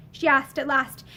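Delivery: plain reading voice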